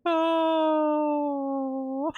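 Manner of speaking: high, slowly sinking wail; long squeal. Real, not acted. Reader overcome by the romantic scene, long squealing wail. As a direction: hold a high wail of excitement.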